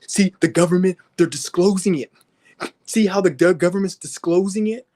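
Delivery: high, mocking voice of a gullible believer. Mocking a believer who cites the government. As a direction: mimic the dupe who trusts the government. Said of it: "yo stupid ass".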